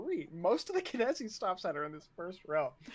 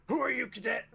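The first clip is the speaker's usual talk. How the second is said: raised, higher drill-officer challenge; officer's demand; 7 semitones higher, 5 dB louder, faster than usual. Acted. Officer demanding a new cadet's name, raised commanding voice. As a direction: challenge the cadet sharply, voice lifted.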